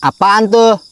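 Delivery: high, loud startled cry. Real, not acted. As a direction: jump at a sudden noise. Startled by a noise at night.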